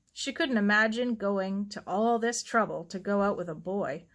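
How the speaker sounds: usual speaking voice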